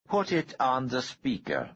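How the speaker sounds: the calm threat of a man holding a bomb trigger; menacing Harrington. A narrator's reading. Bomber gives order.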